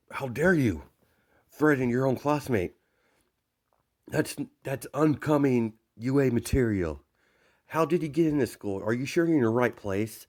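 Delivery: acted indignant rebuke; indignant yelling. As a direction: tell off a classmate in mock outrage. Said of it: "he hears Ida yelling at Bakugo".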